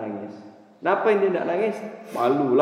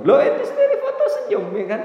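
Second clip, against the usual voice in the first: laughing; 9 semitones higher, 4 dB louder, faster than usual. Retold or imitated. Mocking the angry man.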